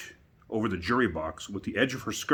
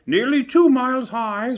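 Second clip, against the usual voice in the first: very high, very loud, slow shriek; a haughty queen jeering at Alice; queen's voice; 11 dB louder than usual. A narrator's reading. The queen mocking Alice's size, very high, loud and slow. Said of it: "said the queen".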